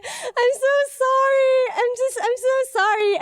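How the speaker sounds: imitated crying apology. Crash victim clings to the driver's shoes, imitated crying apology. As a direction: apologize in a hammed-up, high crying voice. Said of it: "while I'm crying".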